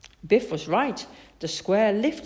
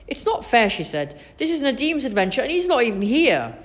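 higher, louder grumble; complaint; 7 semitones higher, 4 dB louder than usual. A narrator's reading. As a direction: protest like an aggrieved child. Biff complains about the adventure.

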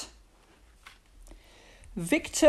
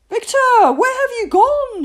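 high, loud mother's call; searching, high-pitched holler; calling from afar; 13 dB louder than usual. A narrator's reading. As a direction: call out across a long distance. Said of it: "his mother calling in the distance".

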